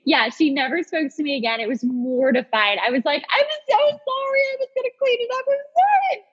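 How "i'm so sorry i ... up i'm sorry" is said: high, fast, squeaky panicked apology; mock-panicked pleading, much higher; frantic apology. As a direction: gush the apology like a mortified girl. Retold apology to the bride.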